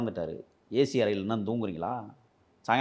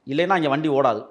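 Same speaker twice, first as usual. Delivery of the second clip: loud accusation; 5 semitones higher, 7 dB louder than usual. Real, not acted. Loud accusation about police bribes.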